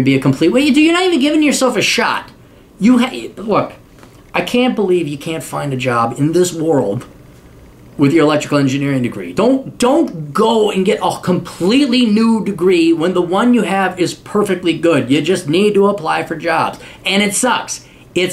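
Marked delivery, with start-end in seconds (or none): none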